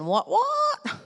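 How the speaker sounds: high, squeaky "what, what?" of shock; startled disbelief. Retold or imitated. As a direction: jump way up in pitch, caught off guard. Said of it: "I am sure Moses ... was saying".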